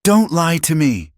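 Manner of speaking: high, accusing outcry; anguished cry. A narrator's reading. Woman shoving the man back, crying out. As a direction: cry out at being lied to. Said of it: "she cried".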